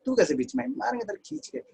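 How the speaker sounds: high, hammed-up scolding; mock angry scolding. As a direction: snap at her like a cartoon bully. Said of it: "इसको कूट देते हैं". (ऊँची आवाज़ में बनावटी डाँट)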